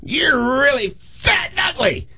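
very high, slow parrot screech; shrill cartoon parrot, words stretched out; squawking parrot. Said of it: "this parrot goes".

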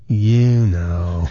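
low, drawn-out parrot punchline; knowing parrot. Acted. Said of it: "he just looks at her and goes".

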